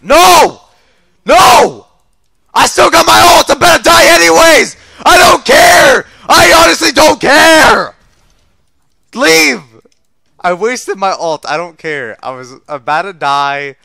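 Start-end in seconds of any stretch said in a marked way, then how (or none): 0.0-9.6 high, loud frantic yelling; desperate shouting. Real, not acted. Gamer about to die in game, desperate shouting. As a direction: shout defiantly as you go down.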